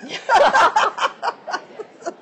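loud high laugh; loud burst of laughter. Real, not acted. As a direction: break out laughing hard. Laughing at the Pulp Fiction joke.

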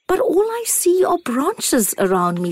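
put-on high, small childlike acorn; a tiny wondering acorn. A narrator's reading.

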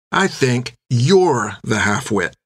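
slow, cold and deliberate; slow deliberate insult. Acted. Finn leaning in on Bill, slow cold insult. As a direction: lean in and land each word.

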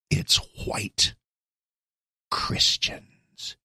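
hushed, ominous whisper of a smear; sinister whisper. Retold or imitated. Host mocking Joy Reid in a sinister whisper. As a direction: whisper it like a dark accusation. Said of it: "Sound effect mine".

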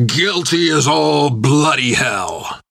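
growled, defiant shout; loud low growl. A narrator's reading. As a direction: spit it back defiantly. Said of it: "in a loud, low growl".

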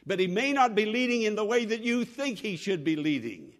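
ordinary talk

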